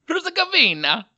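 loud, high mock Italian accent. Podcaster playing with a country name, exuberant mock Italian accent. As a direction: belt it out in a hammed-up Italian accent. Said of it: "say a Bosnian word with a Italian accent".

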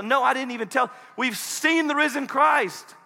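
high-pitched exclamation; acting elated, stretching the words out; excited. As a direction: burst in with thrilling news. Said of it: "come running up excitedly".

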